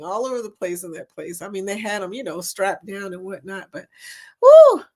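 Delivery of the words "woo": high loud exclamation; loud woo. Real, not acted. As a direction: shriek it out with relief. Remembering the scare.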